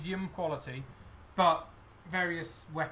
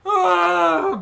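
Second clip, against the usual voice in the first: loud high mock wail; bursting into tears; 14 semitones higher, 15 dB louder than usual. Goblin breaking down before the inspector, loud acted wail. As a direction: burst out in a big, high, hammed-up sob. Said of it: "bursts into tears".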